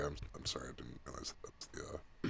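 whispered, embarrassed apology; sheepish whisper. Real, not acted. Player apologising to the GM, whispering. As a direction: mutter the sorry under your breath.

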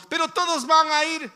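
mock child whine that everyone is going; whining child. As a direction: complain like a kid who's been told no. Child protesting parent's no.

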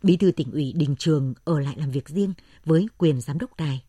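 ordinary delivery, nothing marked

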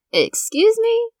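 high, drawn-out indignant squeak; squeaky exaggerated offense; mock offended. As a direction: act scandalized, going way up.

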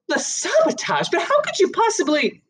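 high, stunned disbelief; a shaken woman caught out; shocked woman. Acted. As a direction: pitch right up in shock. Mrs Barron shocked by accusation.

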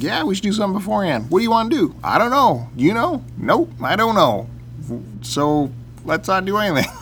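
lightly acted dithering between friends; quick back-and-forth retold. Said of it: "It's like".

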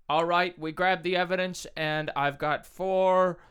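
high, slow dopey cop voice; slow dumb cop drawl. Pretend. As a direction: play the cop really dim and slow. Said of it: "as a really dumb cop".

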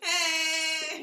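long, high, loud "hey"; loud jeer. Real, not acted. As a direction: holler it out, stretched and high. Heckling a joke with a shout.

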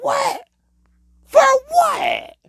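very high squealed questioning; mock disbelief. Man baffled by anger over Kool-Aid, high squealed disbelief.

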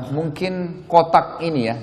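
ordinary talk

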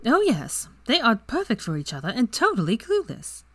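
a touch of bright Ginny; Ginny's voice. A narrator's reading.

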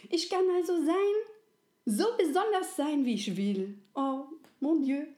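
high, loud, fast and delighted; overjoyed. A narrator's reading. The little soul rejoices to Resi, high and loud. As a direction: bubble over with happiness. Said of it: "lachte voller Freude".

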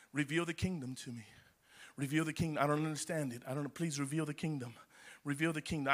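low, soft, pleading prayer. Retold or imitated. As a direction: pray it quietly, almost to yourself. Quotes his own pleading prayer.

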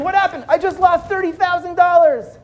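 high, urgent voices; frantic exchange. Acted. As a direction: voice them in alarm. Crowd and victim exchange.

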